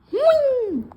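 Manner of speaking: high, loud "whing" sound effect; imitated whoosh. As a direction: fling the noise out sharply upward. Imitates flinging body into vehicle.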